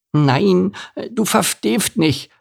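very high lisping little donkey; Elimar, desperate. A narrator's reading. Little donkey pleads with the camel to understand, desperate high lisp. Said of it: "sagte Elimar verzweifelt".

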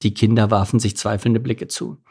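usual speaking voice

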